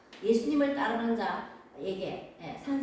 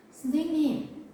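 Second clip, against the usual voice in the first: raised, louder call to a teacher; 8 semitones higher, 7 dB louder, slower than usual. Acted. Blind Bartimaeus to Jesus, calling out. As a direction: call out high and loud.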